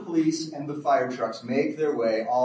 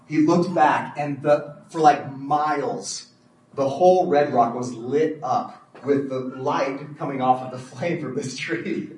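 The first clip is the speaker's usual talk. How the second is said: normal talking voice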